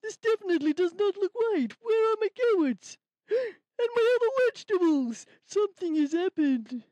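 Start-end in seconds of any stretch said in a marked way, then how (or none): 3.1-5.2 shocked gasp then worried; alarmed discovery; gasping surprise. Acted. Rabbit discovers loss.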